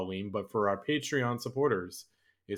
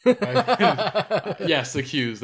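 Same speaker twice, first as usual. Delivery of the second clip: laughing through the words; giggling out the protest; 8 semitones higher, 7 dB louder, much slower than usual. Real, not acted. Laughing at accusation.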